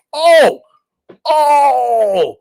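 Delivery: very high, loud cry of "Oh!"; sudden realization. Real, not acted. Streamer suddenly remembering the Soto item, with a sudden loud cry. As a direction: burst out as it hits you.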